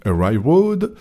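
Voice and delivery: usual speaking voice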